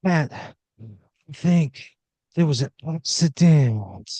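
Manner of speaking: high, slow breathy croon; breathy talk-down. Acted. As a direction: purr it like a glam rock star. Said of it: "he goes".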